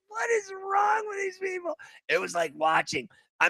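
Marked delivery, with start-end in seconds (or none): 0.1-1.7 very high, strained disbelieving cry; exasperated cry. Retold or imitated. Host reacting to the threat on TV, high exasperated cry. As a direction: wail your disbelief. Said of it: "I'm like".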